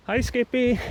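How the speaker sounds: high and breathless; puffing out a greeting; out of breath. Real, not acted. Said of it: "Sorry, I'm so out of breath".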